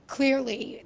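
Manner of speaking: ordinary delivery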